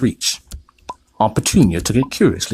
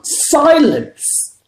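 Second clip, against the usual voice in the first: much higher, louder yell; 6 dB louder than usual. A narrator's reading. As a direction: shut the boy up. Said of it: "yelled Uncle Vernon".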